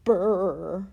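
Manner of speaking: loud shuddering brrr; shiver sound. A narrator's reading. Boy shivers as cobwebs land in his hair, loud brrr.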